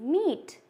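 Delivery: "meet" with a long, held ee; long E sound. Shown as a demonstration. Teacher showing what two vowels together sound like, long E. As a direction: draw out the ee in "meet". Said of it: "long ई sound".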